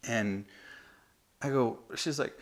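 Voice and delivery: ordinary talk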